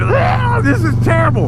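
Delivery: high, hammed-up cry of disgust; mock gasping disgust. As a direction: imitate passengers wailing at a stink. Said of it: "everybody on the plane starts gasping".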